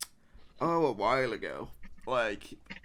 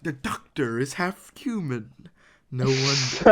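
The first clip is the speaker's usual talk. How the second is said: lightly hammed-up villain, slowly drawn out; the Master impression; 3 dB louder than usual. Fan quoting the Master to friends, light impression. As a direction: deliver it as a slow, mock-dramatic movie villain. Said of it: "That's a good impression".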